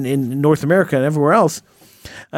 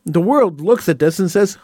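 ordinary talk, nothing marked

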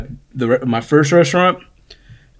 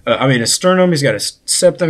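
ordinary talk, nothing marked

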